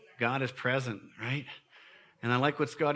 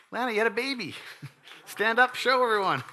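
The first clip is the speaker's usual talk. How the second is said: high, loud joyful calling out; excited call; 10 semitones higher, 5 dB louder than usual. Real, not acted. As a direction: cheer her up to stand. Celebrating a new baby.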